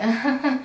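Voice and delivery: short imitated awkward chuckle; forced, nervous titter, mimicked; uncomfortable laugh. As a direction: mimic a bystander's uneasy little laugh. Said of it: "She's kinda laughing uncomfortably".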